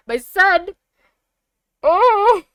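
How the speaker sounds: excited squeal. Real, not acted. Gushing over her favorite character, loud excited squeal.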